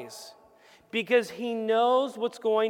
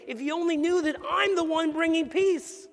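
higher, earnest voicing of Jesus; 7 semitones higher than usual. Jesus pleading with Jerusalem, in a higher earnest voice. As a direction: lift into Jesus' plea. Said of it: "Really saying".

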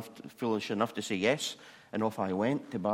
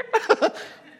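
burst of laughter; 8 dB louder than usual. Retold or imitated.

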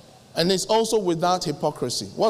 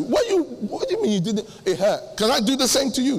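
normal talking voice

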